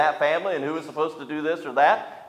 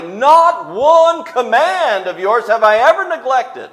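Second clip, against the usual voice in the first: mock boast; 10 semitones higher, 12 dB louder, slower than usual. Voicing son's boast, laughing.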